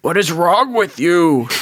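half-hurt complaint of a dying man; stabbed man asks why; mock outrage. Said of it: "and he's like".

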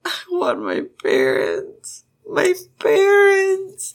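slow sobbing wail, leaping high; acted tearful child's plea, drawn out; starting to cry. Jiang Cheng just a kid crying for his parents, sobbing. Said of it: "He starts to cry".

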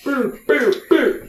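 very high chanted beer beer; gleeful oaf. Acted. GM as Elmo runs back to the tavern, high gleeful chant. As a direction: trot off singing out for beer.

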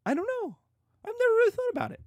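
put-on very high, innocent girl voice; mock naive Juliet. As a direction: play it wide-eyed and clueless. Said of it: "And Juliet's like".

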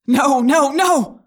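loud, high cry of panic. Acted. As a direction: shout the refusal in terror. Jake cries out in horror.

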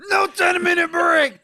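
high, slow, full-force mock yell; imitated boss shout. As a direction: roar it like the furious elf boss. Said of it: "How did he go from".

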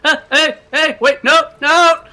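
very high, very loud panicked cry; Rodney trapped in the folding boat, yelling in panic; panicked yelp. Acted. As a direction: yell as the boat folds on you.

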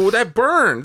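high, loud hammed-up ow; mock pain. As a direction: pretend a hot logo burned you. Joking the logo burns them.

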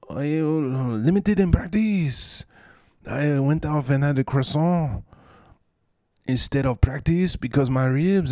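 high, slow put-on French accent; mock French accent. Injured player in mock French accent about croissants. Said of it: "some type of Spanish accent".

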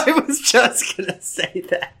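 high, laughing through the words; real laughing. Real, not acted. Co-host admitting the same thought, cracking up. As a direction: squeeze it out while cracking up.

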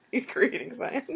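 giggling repeat; laughing. Real, not acted. Echoing joke, laughing.

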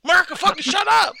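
playful shout to end the argument; mock shout. Telling his friends to drop it, mock shout.

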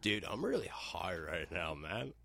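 slow, soft lazy stoner drawl. Retold or imitated. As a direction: drawl it like you're baked. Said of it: "let me try it again".

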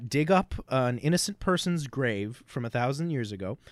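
usual speaking voice

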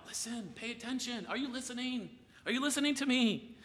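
high-pitched emphatic plea; Jesus. Retold or imitated. Jesus trying to wake his listeners up, emphatic high-pitched appeal. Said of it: "Jesus is so emphatic about".

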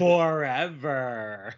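slow, ominous stretched word; ominous drawn-out. Pretend. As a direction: drag it out like a doom verdict. Ominous punchline about eternal punishment.

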